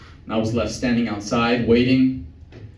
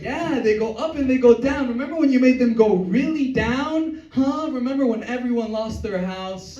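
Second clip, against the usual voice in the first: high, sing-song taunting; mocking wife; 10 semitones higher than usual. Wife taunting her husband about the crash, high and sing-song. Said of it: "She's standing next to him like".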